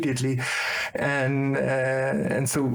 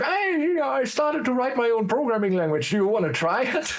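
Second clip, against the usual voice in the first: much higher, light put-on voice; imitating his friend; 11 semitones higher than usual. Friend offers his new programming language, high eager mock voice. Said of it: "but I was like".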